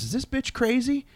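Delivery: high, scornful sneer at a pleading mother; rude dismissive idol. Retold or imitated. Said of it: "and he goes".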